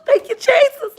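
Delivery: high, laughing relief; laughing thanks. Real, not acted. Thanking Jesus after finally saying the word, laughing. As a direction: giggle through it, very high.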